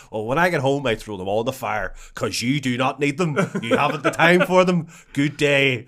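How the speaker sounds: old stranger lecturing on shaving, hammed-up and loud; mock old man. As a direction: play the odd old man at the bus stop, loud and proud. Old man's speech, retold.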